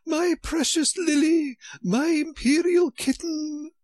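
very high, loud, frantic queen. A narrator's reading. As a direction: shriek for the kitten, high and loud. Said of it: "began scrambling wildly".

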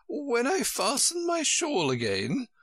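high, mildly groaned queen voice; groaning queen. A narrator's reading. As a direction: moan it lightly in a high character voice. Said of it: "the poor Queen groaned out".

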